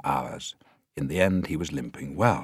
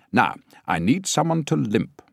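higher, louder director; 7 semitones higher, 5 dB louder than usual. A narrator's reading. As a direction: call the next role out. Director casting.

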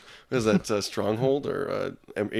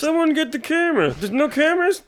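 much higher, frantic mock panic; mock alarm; 3 dB louder than usual. Friends riffing on a revolution, high and frantic. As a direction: squawk it like a panicked reporter.

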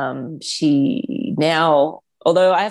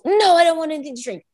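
imitating child's tantrum; 10 semitones higher than usual. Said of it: "she's crying".

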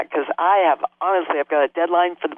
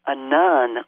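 ordinary delivery

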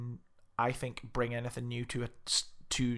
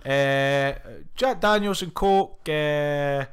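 put-on louder, higher, slow Scottish accent; mock Scottish order; 3 semitones higher, 7 dB louder, slower than usual. Laying out a bar order in New York, mock Scottish accent. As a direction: reel off the order like a Scot at the bar. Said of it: "be like".

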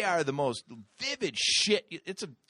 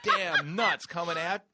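normal talking voice

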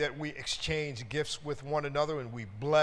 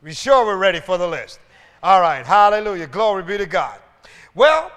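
preaching; 12 dB louder than usual. Acted. Preaching to the congregation.

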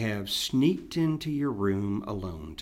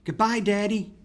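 put-on high, bright little boy's call, louder; higher, louder child's voice calling to his father; child calling goodbye; 10 semitones higher, 5 dB louder than usual. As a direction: call out goodbye like a small boy waving. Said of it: "waved a hand and called".

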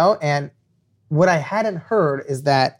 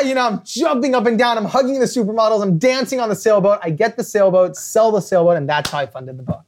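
usual speaking voice

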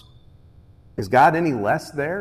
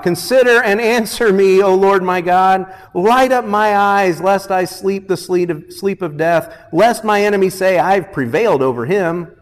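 loud, raised psalm plea; crying out; 6 semitones higher, 6 dB louder than usual. Acted. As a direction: cry out to God with full voice. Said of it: "David cries out".

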